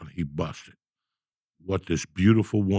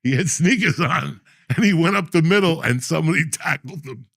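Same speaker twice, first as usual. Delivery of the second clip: excited storytelling; 8 semitones higher, 4 dB louder than usual. Retold or imitated. Laughing retelling of the football tackle.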